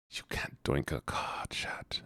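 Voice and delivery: hushed mock German accent; whispered German-accented scolding.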